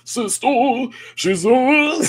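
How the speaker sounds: high, loud mock karaoke singer. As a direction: belt it like a bad karaoke impression. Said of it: "That's the karaoke voice".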